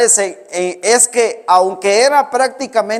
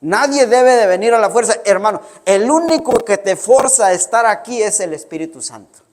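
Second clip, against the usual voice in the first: ordinary delivery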